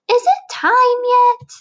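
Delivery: put-on high, slow pleading kid voice; a wriggling little bug begging to be done; squeaky caterpillar asking again; eager caterpillar. Teacher voices impatient caterpillar.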